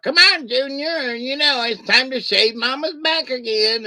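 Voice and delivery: put-on sing-song mother calling her boy to chores; hammed-up mom voice; mother calling son. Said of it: "she was like".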